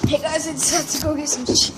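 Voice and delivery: normal talking voice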